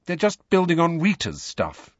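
high-pitched, held-in explanation; forced calm. A narrator's reading. Hermione explains the stories to Harry, with forced calm. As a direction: carry on with careful, forced calm. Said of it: "in a voice of forced calm".

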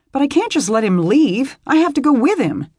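high, loud pleading; desperate to follow him; distressed plea. Acted. Beth pleading.

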